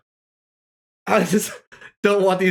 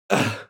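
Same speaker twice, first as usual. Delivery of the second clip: low, soft sighing groan; weary sigh; 9 semitones lower, 4 dB quieter than usual. Real, not acted. Tired after stretching.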